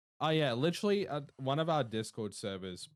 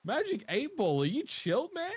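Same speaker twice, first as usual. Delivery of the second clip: high, loud disbelieving appeal; a loud, high, baffled plea; incredulous plea; 5 dB louder than usual. Real, not acted. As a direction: call out to it, way up high. Pleading with the Eight Ball.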